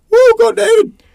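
loud cheering shout-out; hype shout. Real, not acted. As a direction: whoop it up for a buddy. Hype shout-out for credited writer.

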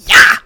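loud, abrupt shriek as something leaps out; imitated attacker's burst; sudden attack yell. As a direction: explode in one startling yell. Creature jumps out of garage.

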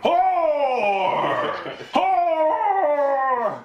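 high, long drawn-out accusing howl; exorcist priest. Acted. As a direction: bellow the word like an overwrought exorcism. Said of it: "he's just like".

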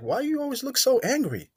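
a hint of a teasing girl; imitating his woman.

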